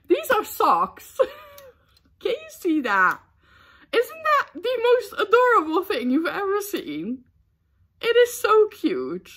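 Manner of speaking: high-pitched squealing delight; gushing over cuteness. Real, not acted. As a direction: squeal over the most adorable present. Gushing over cute socks she received.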